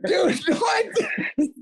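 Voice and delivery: high, laughing protest; laughing denial. Real, not acted. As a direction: deny it while cracking up. Laughing denial of changing number.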